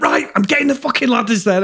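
high-pitched mock-exasperated outburst; retold exasperation. Friend retelling a daft night to co-host, mock exasperation.

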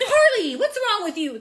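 loud high scold at a pet that pooped on the carpet; scolding a dog. Retold or imitated. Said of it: "That's how they sounded".

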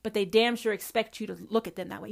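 ordinary talk

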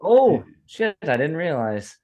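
ordinary talk, nothing marked

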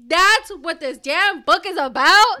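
loud, high, drawn-out disbelief; slow it down and shout in disbelief; incredulous outburst. Real, not acted. Cohost reacting to the book's familiar plot, incredulous outburst.

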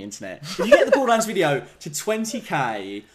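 ordinary talk

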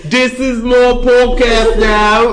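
slow, high Australian-Cockney mix; tone-deaf Australian Cockney accent. Pretend. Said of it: "What accent was that?".

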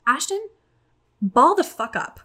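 high, punchy retold pep talk; stern self-talk. Woman to herself mid-meltdown, stern pep talk.

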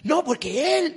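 "No, porque él" thrown out high and loud in a whiny tone; mocking wife's excuse. Preacher imitating a wife blaming her husband, high whiny mimicry.

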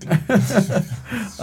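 high burst of laughter. Real, not acted. Laughing at the friend's remark.

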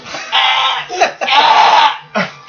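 coughing laugh, choking on candy; choking while laughing. Real, not acted. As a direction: choke and laugh through it. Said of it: "I'm choking on Skittles".

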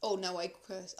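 usual speaking voice

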